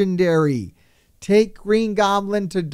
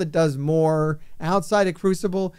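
normal talking voice

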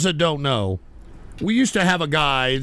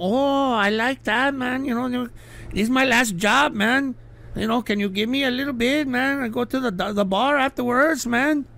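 begging neighbor voice; 7 semitones higher than usual. Retold or imitated. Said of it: "they're gonna be like".

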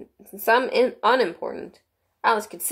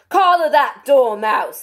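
high, loud, slow shrieked order; Queen shrieking; 6 semitones higher, 8 dB louder, slower than usual. A narrator's reading. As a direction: shriek it as an imperious command. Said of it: "the Queen shrieked out".

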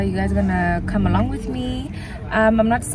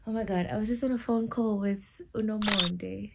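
hushed, stunned; 10 dB quieter than usual. Real, not acted. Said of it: "I'm lost for words".

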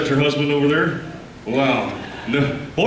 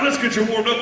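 usual speaking voice

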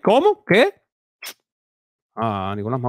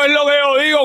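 much higher, gushing like a lovestruck fan; mock swooning falsetto; 10 semitones higher than usual. Mocking the woman in the clip with a high swoony voice. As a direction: coo it up high, all swoony.

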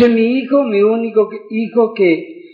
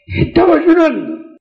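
crying; 9 semitones higher than usual. Real, not acted. Preacher to his listeners, remembering the church, crying. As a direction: voice breaking into tears, pitched high. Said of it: "y estaba llorando".

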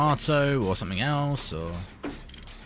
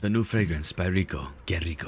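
low, soft perfume ad voice; sultry perfume ad; 8 semitones lower, 5 dB quieter than usual. Pretend. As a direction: purr it like a perfume commercial. Mock perfume advert.

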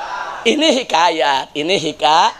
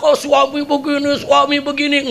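nagging wife; 6 semitones higher than usual. Acted. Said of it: "Ngomel dia".

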